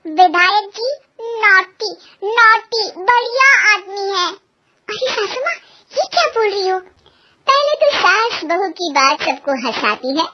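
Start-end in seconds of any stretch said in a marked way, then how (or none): 4.3-7.9 higher, alarmed cry; mock shock. Daughter-in-law, startled by her own slip, mock shock. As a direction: gasp it out, pretending alarm.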